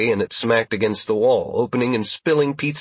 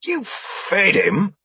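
surprised; 10 semitones higher, much slower than usual. Acted. Surprised narrator question.

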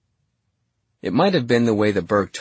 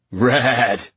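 eager, impressed exclamation; a touch of excitement; roused; 3 semitones higher, 4 dB louder than usual. A narrator's reading. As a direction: say it with awed enthusiasm.

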